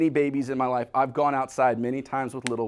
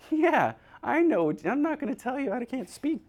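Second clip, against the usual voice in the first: mock infant talking back, pitched way up; baby's voice; 9 semitones higher than usual. Voicing the baby's imagined reply.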